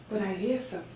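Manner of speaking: very soft and low; soft, low, tentative noticing; hushed discovery. Acted. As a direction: say it quietly, as if just catching a sound. First notices a new voice.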